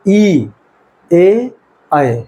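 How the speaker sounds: ई, ए, ऐ each said alone and clearly, one by one; vowel demo. Shown as a demonstration. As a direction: demonstrate ई, ए and ऐ separately with a pause after each. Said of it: "आप उच्चारण करके भी देख सकते हैं". (स्वर ई, ए, ऐ को अलग-अलग साफ़ बोलकर दिखाना)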